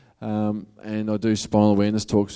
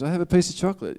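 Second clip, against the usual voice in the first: much higher, tempting little inner voice; 9 semitones higher, faster than usual. Retold or imitated. Inner voice tempts the dieter toward chocolate, much higher and coaxing. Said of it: "the little voice goes".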